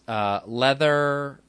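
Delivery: ordinary delivery, nothing marked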